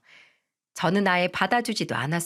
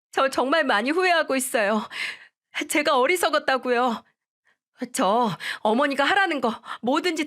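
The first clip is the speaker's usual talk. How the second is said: put-on higher, begging young wife voice; pleading daughter-in-law; 6 semitones higher than usual. Daughter-in-law begging mother-in-law and husband, higher pleading voice. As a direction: plead desperately, a touch tearful in tone.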